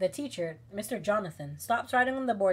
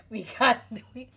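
ordinary talk, nothing marked

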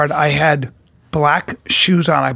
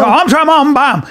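high, emphatic mimicry; pitched-up insistent retort; shoe shopper imitation; 7 semitones higher than usual. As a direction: correct the quote in the shopper's high voice. Other host imitates the same shopper.

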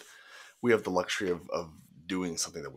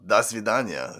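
hammed-up Russian goodbye; loud Russian accent; 4 semitones higher, 6 dB louder than usual. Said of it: "Russian accent".

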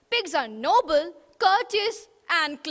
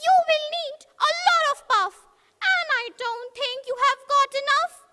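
much higher, fast and loud; taunting little pig; 10 semitones higher, 6 dB louder, faster than usual. Acted. Pig mocking the wolf, high and fast.

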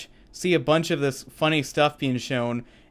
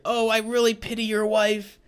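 much higher mock Deadpool voice; Deadpool quip; 9 semitones higher than usual. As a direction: toss the line up high, teasing. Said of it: "He's like".

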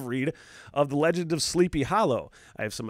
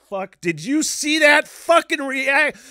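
mock excited outburst; 11 semitones higher, 8 dB louder, slower than usual. Retells his own excited reaction.

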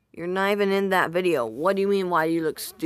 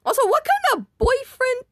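mock squeal; 7 dB louder than usual. Reacting to an old video.

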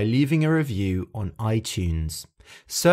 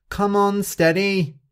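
high cheering call; shouting encouragement; 9 semitones higher, 4 dB louder than usual. Acted. The animals cheering Steady on, high and loud. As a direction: cheer her on, pitched high. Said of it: "other animals shouting".

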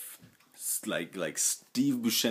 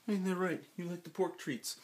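much higher voice, talking to a dog; doting pet talk; 11 semitones higher than usual. Real, not acted. Owner asking his dog about the pork treats, high doting pet talk. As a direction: pitch it up as if to a pet.

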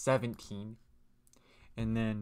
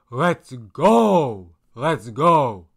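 loud triumphant cheer; excited shout; 11 dB louder, much slower than usual. Real, not acted. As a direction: yell it like you just won. Celebrating solving the puzzle.

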